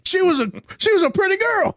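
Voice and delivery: very high-pitched comic lament; mock anguished realization. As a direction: wail it in belated, hammed-up regret. Acting out belated regretful realization.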